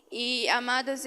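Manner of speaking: ordinary delivery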